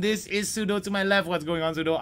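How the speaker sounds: normal talking voice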